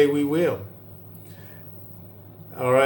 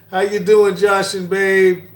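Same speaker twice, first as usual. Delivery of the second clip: high, loud, delighted hello; excited greeting; 5 semitones higher, 5 dB louder than usual. Real, not acted. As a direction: greet them brightly and loudly.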